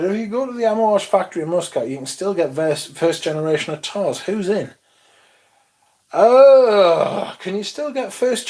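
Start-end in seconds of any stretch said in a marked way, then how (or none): none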